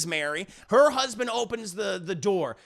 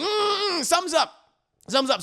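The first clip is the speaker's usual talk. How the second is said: high, louder mock suspicion; acted suspicion; 9 semitones higher, 4 dB louder, faster than usual. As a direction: play the husband smelling a lie. Husband grows suspicious.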